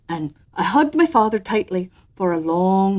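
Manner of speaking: ordinary delivery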